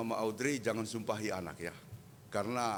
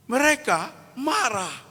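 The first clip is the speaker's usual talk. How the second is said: high, loud preaching voice; full-force preaching; 9 semitones higher, 10 dB louder than usual. Acted. Preacher tells of Israel angering God, full-force preaching. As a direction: thunder the scripture at the congregation.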